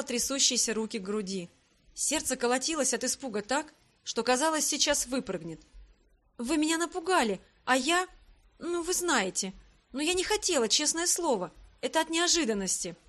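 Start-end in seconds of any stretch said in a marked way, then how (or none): 6.4-13.0 higher-voiced, flustered self-defence; flustered excuse. A narrator's reading. Startled heroine excusing herself to the emperor, flustered excuse. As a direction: plead that it was an accident.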